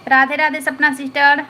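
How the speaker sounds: ordinary talk, nothing marked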